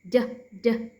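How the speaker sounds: "d" said as a short "duh"; two separate voiced taps of "d". Shown as a demonstration. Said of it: "Because the D sound".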